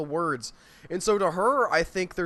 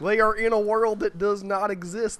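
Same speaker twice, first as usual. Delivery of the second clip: mock West Virginia drawl. Podcaster mocking fantasy accents, in a West Virginia accent. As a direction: play a folksy West Virginia yokel. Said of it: "the sort of British accent".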